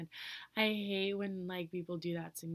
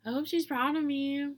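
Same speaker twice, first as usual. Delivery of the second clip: heartfelt exclamation; 8 semitones higher, 11 dB louder than usual. Real, not acted. Exclaims wish about younger self.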